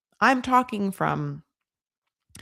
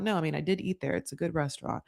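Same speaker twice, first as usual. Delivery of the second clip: much softer, backing-off reply; mock quiet protest; 4 semitones lower, 10 dB quieter, faster than usual. Trish answering Rick about the restaurant, quietly defensive. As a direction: imitate someone softly standing their ground.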